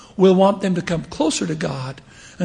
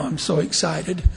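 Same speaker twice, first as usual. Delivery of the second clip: usual speaking voice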